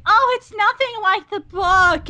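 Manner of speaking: high, drawn-out imitated moan; mock complaining. Friends who read the book, imitated high complaint. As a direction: stretch it high, mimicking griping fans.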